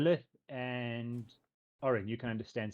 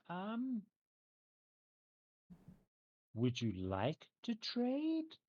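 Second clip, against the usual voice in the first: put-on slow, sugary teen offer; sweet teenage girl voice; 8 semitones higher, 3 dB quieter, much slower than usual. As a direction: coax them sweetly, very slowly.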